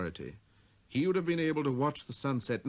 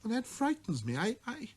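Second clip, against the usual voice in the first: put-on high, abashed child's voice; little prince murmuring; 10 semitones higher than usual. A narrator's reading. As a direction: stammer it out, embarrassed. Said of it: "murmured the little prince".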